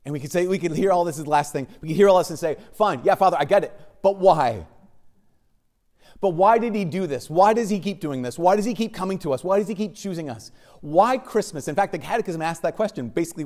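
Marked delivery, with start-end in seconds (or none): 2.7-11.7 higher, louder, insistent pleading; exasperated questioning. Acted. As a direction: voice a baffled listener pressing for reasons. Voicing a congregation's exasperated questioning.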